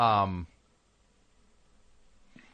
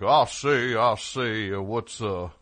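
imitating a Southern cartoon rooster; mock Southern cartoon voice; Foghorn Leghorn; 2 semitones lower, slower than usual. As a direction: put on a blustering cartoon Southern voice. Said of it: "Mel Blanc ... suddenly going like".

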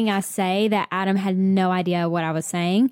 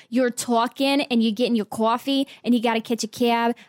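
imitated New Jersey accent. Co-host fondly mimicking her friend, Jersey accent. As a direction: tease with a put-on Jersey accent. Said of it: "Your Jersey accent".

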